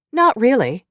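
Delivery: high and loud, defiant; piped up. A narrator's reading. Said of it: "Grace piped up".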